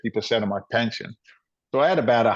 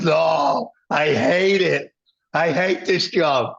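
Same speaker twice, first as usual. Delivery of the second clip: a weary friend venting, half-shouted; fed-up outburst; 5 semitones higher, much slower than usual. Retold or imitated. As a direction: groan out how much he hates it. Friend admits he hates job.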